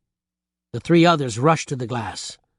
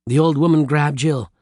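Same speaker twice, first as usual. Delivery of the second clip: ordinary delivery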